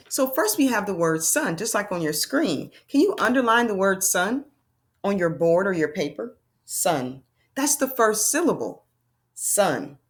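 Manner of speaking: normal talking voice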